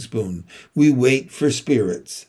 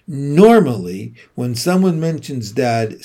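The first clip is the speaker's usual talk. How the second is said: usual speaking voice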